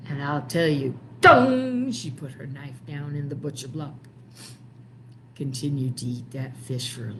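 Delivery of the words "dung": thunk sound. Acted. Knife thunks into block.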